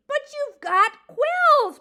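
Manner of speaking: high, loud, slow protest. A narrator's reading. Mouse protesting to the porcupine, loud high protest. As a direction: drag it out in outrage. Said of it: "Poppy protested".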